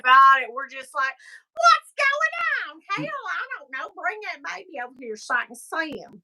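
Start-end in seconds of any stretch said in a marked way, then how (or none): 1.5-6.2 high start, hammed-up Southern voice; crazy Southern accent. Joking about accents, hammed-up Southern voice. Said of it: "getting into crazy accents now".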